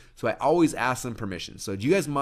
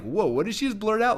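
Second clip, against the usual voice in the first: high, loud mock astonishment; startled disbelief; 12 semitones higher, 5 dB louder than usual. Audience member reacting to the blurted pitch, acted startled. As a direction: replay a baffled reaction, voice shooting up.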